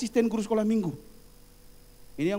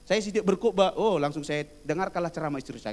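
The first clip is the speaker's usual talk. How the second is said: ordinary talk, nothing marked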